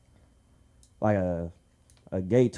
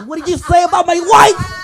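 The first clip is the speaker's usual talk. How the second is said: much higher, louder laughing yell; mock outraged husband; 12 dB louder than usual. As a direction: yell like a squeaky offended husband.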